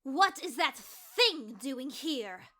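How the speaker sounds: slow, loud, high-pitched indignation; outraged contempt. Acted. Icicle sees the Skywing across the cavern, loud outrage. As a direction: spit it out slowly and loudly, appalled. Icicle, one word from attacking.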